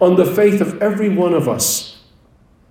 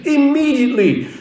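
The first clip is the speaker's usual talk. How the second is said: ordinary talk, nothing marked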